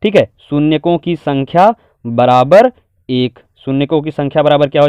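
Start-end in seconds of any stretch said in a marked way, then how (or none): 0.5-3.4 measured, word by word; slow dictation. Shown as a demonstration. Teacher writing the answer out in Hindi, slow dictation. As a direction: spell out the answer slowly for writing. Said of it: "Hindi में लिखेंगे".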